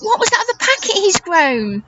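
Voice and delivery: ordinary delivery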